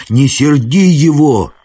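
high, slow urgent call; alarmed warning. A narrator's reading. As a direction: call after a reckless friend to stop. Hero calls after rash companion.

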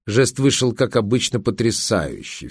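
ordinary delivery, nothing marked

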